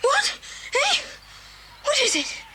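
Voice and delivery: high-pitched jolt of confusion; acted startled bewilderment; startled awake. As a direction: wake with a start, voice leaping up. Boy jolted awake by parrot.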